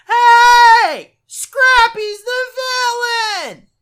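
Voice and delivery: high, loud, slow spoiler shout; mock yell. Spoiler jerk yelling the twist, slow high and loud. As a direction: bellow the spoiler. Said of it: "other fucking asshole who's like".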